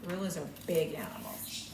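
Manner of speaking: soft whisper about big gorillas; whispered aside. Real, not acted. Teacher whispering to children about gorillas, soft whisper. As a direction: hush it like a secret.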